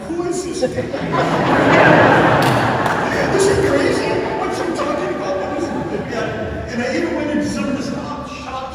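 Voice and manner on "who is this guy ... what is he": high, loud mock bewilderment with laughter; staring tourists' mock disbelief; baffled tourists. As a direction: play the puzzled onlookers for laughs. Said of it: "they looked at me like".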